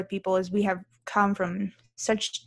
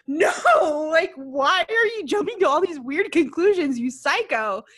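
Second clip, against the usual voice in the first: louder, pitched up and laughing through it; laughing protest; 6 semitones higher, 5 dB louder than usual. Retold or imitated. To the doctor, couple objecting to his guesses while laughing. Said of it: "we were like".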